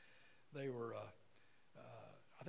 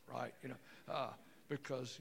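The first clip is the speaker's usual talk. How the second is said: normal talking voice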